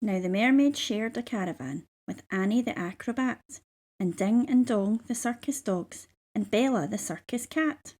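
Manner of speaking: ordinary delivery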